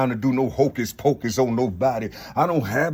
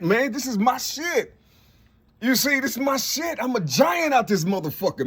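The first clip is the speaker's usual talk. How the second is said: much higher voice, near shouting with joy; fired-up preaching; 6 semitones higher than usual. Real, not acted. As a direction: rant like a prophet lit up from inside. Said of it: "I can't talk like this".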